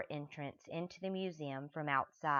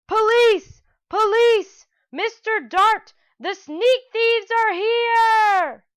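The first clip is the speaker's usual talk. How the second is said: much higher, much louder screaming alarm; loudest terrifying shout; 17 dB louder, slower than usual. Acted. Stanley alerting the guards to the thieves, screaming. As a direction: scream for the police at full volume. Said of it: "he shouted in his loudest, most terrifying voice".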